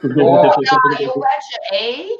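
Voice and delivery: put-on Finnish accent from the U P. Shown as a demonstration. Snowmobiler showing off regional speech, Finnish U P accent. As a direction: lean into a Upper Peninsula Finnish accent. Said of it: "I got my Finnish accent".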